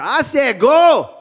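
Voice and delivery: high, loud, drawn-out angry holler; acted yell, ordering with all force. Bride yells at the stalled horse, hammed-up angry holler. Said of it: "She yelled at that horse".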